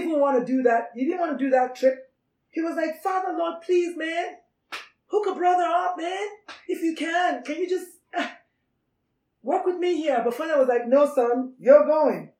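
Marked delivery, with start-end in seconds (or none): 3.0-10.2 high-pitched begging, played casual; comic pleading. Jesus pleading with his Father. 10.9-12.3 loud, final parental no; firm fatherly refusal. Acted. Father refusing the son's plea.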